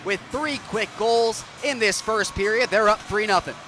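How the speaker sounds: the call jumps high and loud on the shot and goal; excited goal call. Real, not acted. Announcer calling a quick third goal, excited roar. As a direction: explode on the goal, then ride the excitement.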